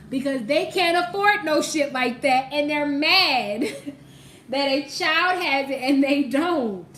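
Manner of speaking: loud, drawn-out, laughing ridicule; loud mocking emphasis. Real, not acted. Reader calling out a jealous parent, loud and mocking.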